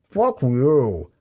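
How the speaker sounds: talking through marshmallow; mouth full. Acted. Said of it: "through a mouthful of marshmallow".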